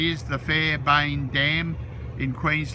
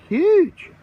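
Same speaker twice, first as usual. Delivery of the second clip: loud high burst of amazement; excited exclaim; 9 dB louder than usual. Real, not acted. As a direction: shout it out in awe. Amazed at the lake.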